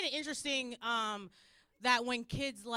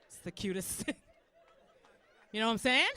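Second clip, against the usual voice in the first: ordinary delivery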